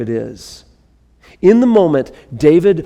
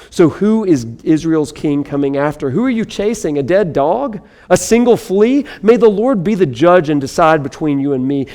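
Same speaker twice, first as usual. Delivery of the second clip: usual speaking voice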